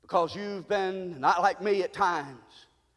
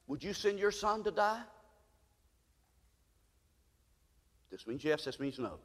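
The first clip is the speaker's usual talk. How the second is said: much softer, low and intimate; hushed preaching; 5 semitones lower, 10 dB quieter than usual. Acted. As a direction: pull them in close. Sermon drops to hushed tone.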